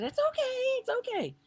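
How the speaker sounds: light imitation of reassuring him. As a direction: soothe him kindly. Comforting the disappointed man.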